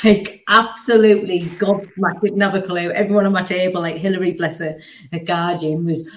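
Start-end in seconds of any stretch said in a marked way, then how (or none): none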